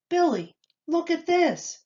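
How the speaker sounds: high, lively father voice; father showing the paper. A narrator's reading. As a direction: call your son over to see. Father shows son the paper.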